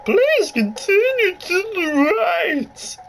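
high put-on voice of the lady game guide; announcer-like high lady voice, slow and bright. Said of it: "I'm not good at voicing".

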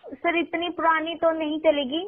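ordinary delivery

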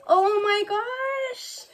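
drawn-out, high delighted oh my gosh; high excited gasp. Real, not acted. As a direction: gasp it out slowly, thrilled. Gasping at gift tin.

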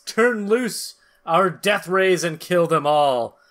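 ordinary delivery

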